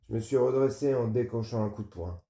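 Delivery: ordinary delivery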